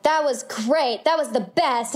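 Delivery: hammed-up overheard raving; mock gushing praise. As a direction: gush slowly, imitating an impressed casting person. Voices praise overheard through audition walls.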